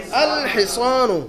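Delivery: الحصان drawn out very slowly, every sound held; teacher slowly modelling الحصان for the class; slow model reading. Shown as a demonstration. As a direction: stretch الحصان out for students to copy. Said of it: "اس لفظ کو کیسے پڑھیں گے ہم؟".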